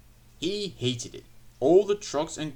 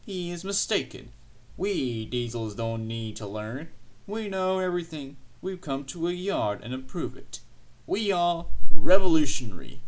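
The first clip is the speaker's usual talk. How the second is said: boastful diesel engine; 3 semitones higher, 13 dB louder than usual. A narrator's reading. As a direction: boast grandly, building to a very loud finish.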